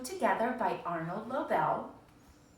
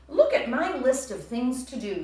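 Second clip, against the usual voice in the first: louder, higher, eager; excited Toad; 6 semitones higher, 9 dB louder than usual. Acted. Teacher voicing Toad showing Frog his list, eager and louder. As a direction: show off the list proudly.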